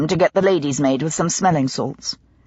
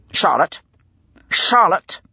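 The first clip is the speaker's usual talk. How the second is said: raging call; slower than usual. A narrator's reading. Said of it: "the rage in his voice".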